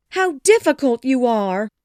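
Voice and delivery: high, loud, frustrated wife; fussing wife at full pitch; exasperated cry. A narrator's reading. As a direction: cry out in exasperation at your husband. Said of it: "she cried".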